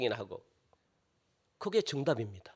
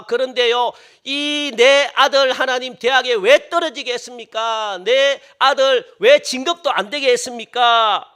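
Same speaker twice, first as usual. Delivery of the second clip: complaining prayer; 4 semitones higher, 7 dB louder than usual. Retold or imitated. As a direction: pour out the grievance at God, building. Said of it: "이렇게 원망한다고요".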